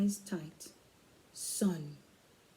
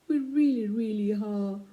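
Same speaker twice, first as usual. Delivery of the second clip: pleading; 2 semitones higher than usual. Acted. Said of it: "kids pleading".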